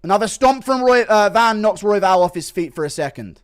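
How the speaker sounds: excited fight commentary; live play-by-play. Real, not acted. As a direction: call the action live.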